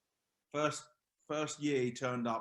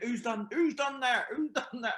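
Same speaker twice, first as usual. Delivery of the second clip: indignant demand; 11 semitones higher, 5 dB louder than usual. Retold or imitated. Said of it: "he'd be giving it".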